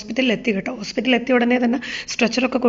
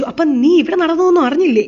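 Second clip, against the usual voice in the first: higher and louder, eager to tell; blurting out the news; mother eagerly asks her son if he heard; excited question; 6 semitones higher, 5 dB louder than usual. Retold or imitated.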